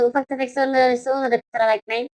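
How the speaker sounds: ordinary talk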